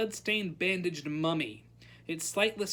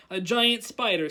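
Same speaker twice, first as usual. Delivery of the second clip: ordinary delivery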